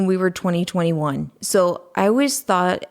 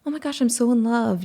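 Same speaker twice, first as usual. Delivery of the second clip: mock lovestruck girl; 6 semitones higher, faster than usual. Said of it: "you hear girls say".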